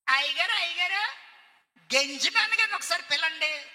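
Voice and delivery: high, loud eager call; calling villager. Acted. Villager calling the pastor, high and loud.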